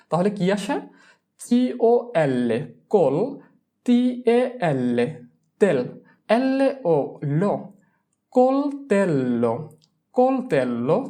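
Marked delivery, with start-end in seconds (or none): 1.4-7.5 syllable-by-syllable spelling. Shown as a demonstration. Teacher shows how consonants split between syllables, slow spelling chant. 8.3-9.5 কোলতেল্লো stretched slowly, syllable by syllable; slow model pronunciation. Shown as a demonstration. Teacher joins the syllables for students, slow and drawn out.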